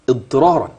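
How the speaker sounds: ordinary talk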